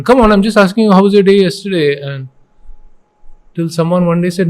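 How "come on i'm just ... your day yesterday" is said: higher, louder exasperated push; teacher pressing a child, mildly exasperated; impatient. Retold or imitated. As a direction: replay your own impatience with a child. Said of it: "get a little impatient with the children".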